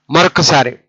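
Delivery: ordinary delivery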